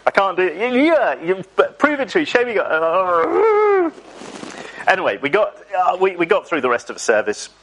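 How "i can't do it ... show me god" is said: high, strained comic squirming; squirming reluctance. Acted. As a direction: wriggle out of it, ending in a mock groan. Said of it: "you wriggle on your seat".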